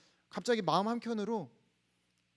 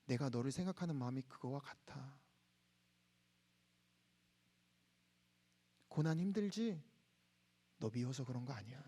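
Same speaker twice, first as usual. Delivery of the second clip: gentle comfort; 7 semitones lower, 8 dB quieter than usual. Retold or imitated. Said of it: "하나님이 말씀해 주는 말씀이".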